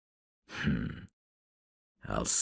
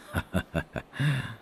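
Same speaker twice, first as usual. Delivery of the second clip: higher rhythmic chuckle; amused chuckle; 9 semitones higher than usual. Acted. Man recalling the professor who punched him, amused chuckle. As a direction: chuckle to yourself in a quick run.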